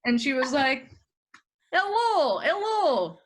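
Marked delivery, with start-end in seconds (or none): 1.7-3.2 put-on high, slow Russian accent caller; slow, high Russian-accented driver on the phone. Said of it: "can you still do a Russian accent?".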